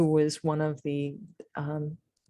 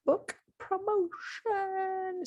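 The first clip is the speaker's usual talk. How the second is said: announcer voice; 5 dB quieter, much slower than usual. Pretend. Mock announcement of segment.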